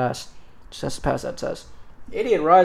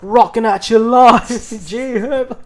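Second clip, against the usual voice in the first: high, slow mock British rap line; a hammed-up British rapper bit; 6 semitones higher, 5 dB louder than usual. Spitting a line as British [name], mock British accent. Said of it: "spit a line in that voice".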